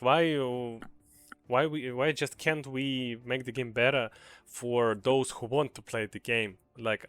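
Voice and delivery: ordinary talk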